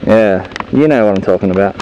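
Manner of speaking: ordinary talk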